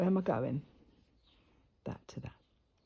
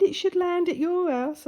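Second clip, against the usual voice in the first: imitating the bird man; 10 semitones higher, 5 dB louder than usual. Retelling the bird man's words.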